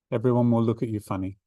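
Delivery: ordinary delivery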